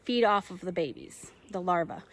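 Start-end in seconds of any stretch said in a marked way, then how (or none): none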